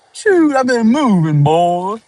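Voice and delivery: high, loud folksy character. Acted. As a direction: answer as a hammed-up country fellow. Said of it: "I wanted to get into more voices".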